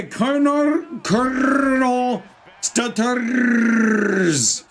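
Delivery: long trilled R in "Colonel" and "Stutters"; rolled R's. Shown as a demonstration. Streamer proves the viewer's name can be trilled, exaggerated rolled R's. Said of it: "I can roll the R's in that name".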